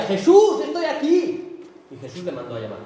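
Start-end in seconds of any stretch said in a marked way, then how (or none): 0.0-1.6 loud high shout, drawn out at the end; insistent calling out. Acted. Preacher as Bartimeo insisting to Jesus, loud high drawn-out shout. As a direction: yell to be noticed over the crowd. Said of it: "Pero él insistía".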